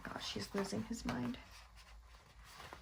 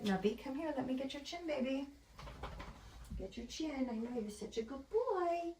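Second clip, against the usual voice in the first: cooing pet talk; 9 semitones higher than usual. Real, not acted. Owner praising Nubby while getting his chin, cooing pet talk. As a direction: coo praise at your pet, pitched way up.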